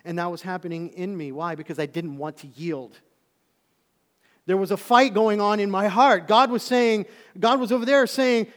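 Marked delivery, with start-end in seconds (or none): 4.5-8.5 loud, high, fervent preaching; preaching at full force. Acted. Preacher to church, crying out the inner fight loudly. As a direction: lift the whole room with it.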